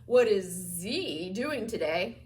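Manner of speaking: Z drawn out long and pointed; slow question with Z conspicuously emphasized; stress on Z. Shown as a demonstration. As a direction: lean heavily and slowly on the word Z. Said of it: "if you emphasize the Z as if commenting".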